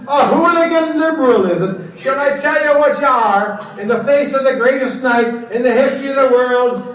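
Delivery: loud, scornful tirade; retold insult. To Bernadette Devlin on air, a loud scornful insult. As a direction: hurl it at her with contempt. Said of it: "I says".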